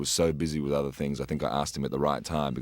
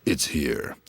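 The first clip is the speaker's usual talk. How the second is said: movie trailer voice. Retold or imitated. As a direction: announce it like an epic movie trailer. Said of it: "my version of movie trailers".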